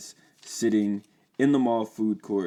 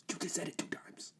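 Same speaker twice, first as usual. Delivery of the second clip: urgent whisper; hissed whisper; 10 semitones higher, 13 dB quieter than usual. Acted. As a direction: whisper it in alarm.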